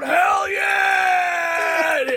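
high shouted hell yeah; party shout. Retold or imitated. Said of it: "I'm going".

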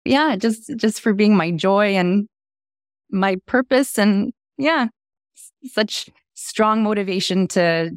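high, tearful and halting; fighting back tears, slow; after crying. Real, not acted. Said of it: "I'm getting teary".

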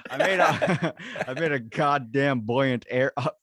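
cracking up while fighting a tongue twister; loud, high, laughing exasperation at each stumble; laughing frustration. Real, not acted.

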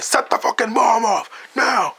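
slow, high, furious yell; shouting at the British agent to blow the bomb; yelled order. Retold or imitated. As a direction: bark the order at the top of the lungs. Hero orders bomb set off.

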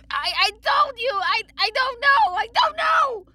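high, loud desperate screaming. A narrator's reading. Tortured blacksmith to the creature, screaming. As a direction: scream it in agony, stumbling over the start. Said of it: "Zabora screamed".

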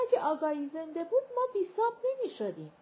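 put-on stumbling old servant pouring out her grief; a tongue-tied old woman's quick lament. A narrator's reading.

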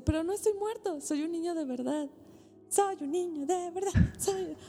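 hammed-up little Pinocho cheering; excited child voice. Pinocho telling Gepetto he is a real boy, high and excited. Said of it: "Así le hace Gepetto".

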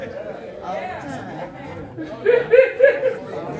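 helpless laughter after a slip; real laugh. Real, not acted. Pastor laughing at his own admission, real laughter.

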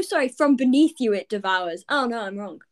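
ordinary delivery

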